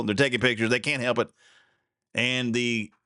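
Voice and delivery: usual speaking voice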